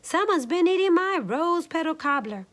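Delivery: high middle-sized bear voice; Mama Honey Bear. A narrator's reading. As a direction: cry it out as an upset mama bear. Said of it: "in her middle-sized bear voice".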